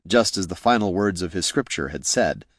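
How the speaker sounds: usual speaking voice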